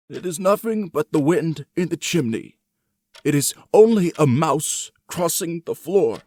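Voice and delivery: high, loud, anxious reassurance; old man's frightened self-talk. A narrator's reading. Old man soothing himself in the dark, high and loud. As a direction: talk yourself out of the fear, high and strained. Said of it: "He had been saying to himself".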